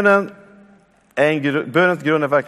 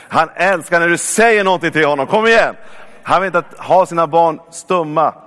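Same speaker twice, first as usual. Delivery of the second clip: emphatic preaching; 3 semitones higher, 3 dB louder than usual. Real, not acted. Preacher urging listeners to speak to God, rousing. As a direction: build to a loud, urgent call.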